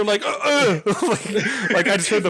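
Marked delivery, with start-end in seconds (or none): 0.2-0.8 high, loud mock grunts of someone tripping; hammed-up grunts of a tripping stranger; stumbling grunts. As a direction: imitate a clumsy stumble with two grunts. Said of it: "No, I didn't hear".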